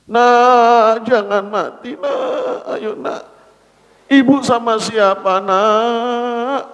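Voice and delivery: wailing lament. Acted. Mother wails over dead child.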